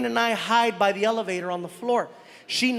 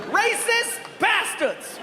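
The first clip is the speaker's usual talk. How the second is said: high, drawn-out indignant cry; outraged cry; 10 semitones higher, slower than usual. Acted. Man heard through hotel door, outraged. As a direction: wail each word in offended disbelief. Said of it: "we heard".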